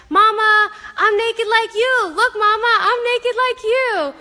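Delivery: put-on high, slow, proud little-kid call; toddler voice. Naked toddler calling proudly to her mom, high and slow. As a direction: announce it like a delighted toddler. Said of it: "butt-ass naked and was just like".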